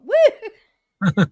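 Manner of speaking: high, very loud woo breaking into laughter; excited whoop. Real, not acted. Host cheering with her listeners, laughing high whoop. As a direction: let out a piercing party whoop.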